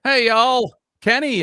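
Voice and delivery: loud, high Southern holler, put on; imitated Southern accent. Host echoing guest's cowboy, loud mock Southern accent. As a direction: call out like a hammed-up Southerner. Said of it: "That's Southern right there".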